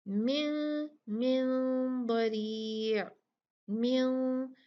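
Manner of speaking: मिन with a hidden, nasal two-count न; ikhfa with ghunna. Shown as a demonstration. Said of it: "गुन्ना देंगे दो काउंट". (मिन के न को छुपाकर दो काउंट गुन्ना)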